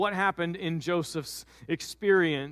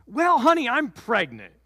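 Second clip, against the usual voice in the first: Mary, bright; 5 semitones higher, 5 dB louder than usual. Acted. Mary breaks the news.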